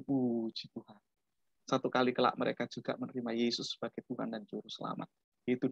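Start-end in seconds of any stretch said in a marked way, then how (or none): 0.0-1.0 low, soft thankful thought; inner voice. Retold or imitated. Boy thanking God in his heart, low and soft. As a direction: say it quietly to yourself. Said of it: "Saya dalam hati".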